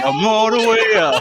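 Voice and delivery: laughing. Real, not acted. Echoing the rush, laughing.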